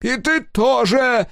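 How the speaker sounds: high, loud, slow angry cry; engineer's shout. A narrator's reading. Engineer shouting at Sega while struggling, high and furious. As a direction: yell it at him in fury. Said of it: "закричал инженер".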